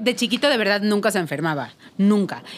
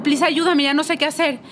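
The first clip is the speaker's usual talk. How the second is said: desperate plea; 7 semitones higher than usual. Retold or imitated. Retelling begging husband for help.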